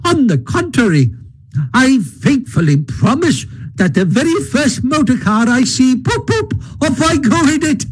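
high, conceited toad voice, flattering; a vain toad buttering up the Badger; boastful Toad. A narrator's reading. Toad's voice in the story.

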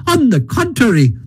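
high-pitched gleeful defiance; emphatic Toad. A narrator's reading. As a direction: vow it grandly, rising with relish. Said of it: "replied Toad emphatically".

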